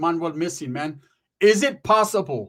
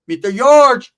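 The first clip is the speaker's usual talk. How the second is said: very loud, high yelled name; yelling at the men before chasing them off; threatening shout; 7 semitones higher, 12 dB louder, slower than usual. Retold or imitated. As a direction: bellow it as a threat.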